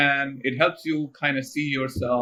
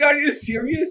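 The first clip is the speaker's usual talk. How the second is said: disbelief; 12 semitones higher than usual. Retold or imitated. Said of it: "I said".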